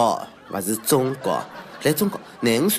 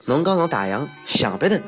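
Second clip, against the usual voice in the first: usual speaking voice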